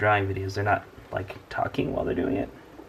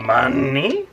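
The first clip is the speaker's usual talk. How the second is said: louder hammed-up crab captain; Mister Krabs voice; 4 semitones higher, 8 dB louder than usual. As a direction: push the bad Krabs voice harder. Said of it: "that was a terrible Mister Krabs impression".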